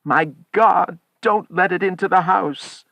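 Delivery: loud, high, terrified plea; trembling fear. A narrator's reading. Old man fears the thing outside, trembling plea. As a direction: beg in alarm, voice pitched high.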